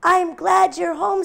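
high, loud, slow relief; mother sheep glad and relieved. A narrator's reading. Mother sheep welcoming her lamb home, slow. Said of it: "said her mother".

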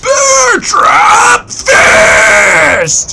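very high, hugely stretched attack scream; drawn-out anime scream. Pretend. Player hamming up his attack like Dragon Ball Z, drawn-out scream. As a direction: scream each word out endlessly, Dragon Ball Z style. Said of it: "screaming that at the top of their lungs".

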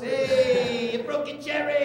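high, sung-out movie mobster cheer; playful mobster quote; Goodfellas wiseguy. Acted. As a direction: call it out like a movie gangster congratulating a kid. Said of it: "Are these Goodfellas?".

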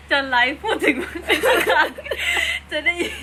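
can barely get it out for laughing; giggling loudly while telling it. Real, not acted. Giggling over the neighbour story.

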